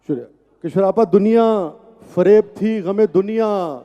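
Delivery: measured, emphatic verse recitation; poetry recital. Acted. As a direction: recite it to a live audience. Recites ghazal at mushaira.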